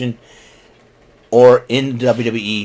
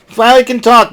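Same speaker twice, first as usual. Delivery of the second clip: "finally can talk" said higher and louder, amused; excited; 10 semitones higher, 5 dB louder than usual. Real, not acted. Amazed she spoke at last.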